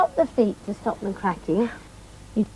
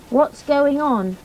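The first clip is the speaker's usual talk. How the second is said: ordinary delivery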